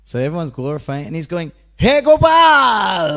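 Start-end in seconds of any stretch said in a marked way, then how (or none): 1.8-3.2 high, very loud drawn-out shout to a friend; joyful call. Retold or imitated. Devotee in the mood of Vrindavan, calling to the Lord, a joyful long shout. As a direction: call out across the hall, Vrindavan style. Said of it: "Like this".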